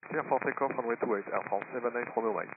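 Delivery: crackly pilot radio readback; over a cockpit radio, clipped; air traffic control radio. Real, not acted.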